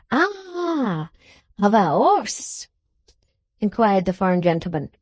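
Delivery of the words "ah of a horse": put-on French accent. A narrator's reading. Foreigner asks.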